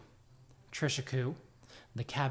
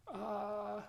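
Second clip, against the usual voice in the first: put-on older halfling voice; 8 semitones higher, 4 dB quieter than usual. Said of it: "he says".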